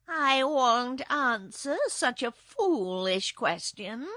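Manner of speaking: loud, slow, scornful cat voice; snarling kitten. A narrator's reading. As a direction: refuse with a drawn-out snarl. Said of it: "asserted Eureka with a snarl".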